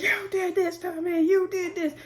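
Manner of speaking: high-pitched mock accusation; retold yelling. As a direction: fling the blame at him.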